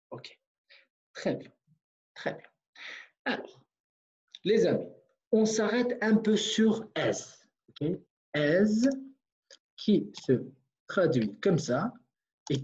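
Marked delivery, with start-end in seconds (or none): none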